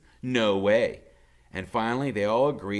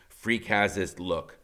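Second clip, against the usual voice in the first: plain reading voice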